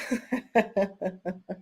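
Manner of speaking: quiet run of chuckles; amused chuckle. Real, not acted. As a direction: chuckle after disagreeing with a friend. Amused after rejecting viewer's theory.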